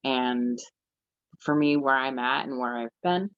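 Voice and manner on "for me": ordinary talk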